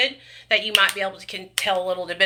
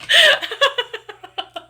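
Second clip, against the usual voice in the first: long high cackle, falling away; high cackling laugh; 14 semitones higher, 5 dB louder than usual. Real, not acted. Streamer cracking up at her chat, high cackle. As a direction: let the laugh burst out high.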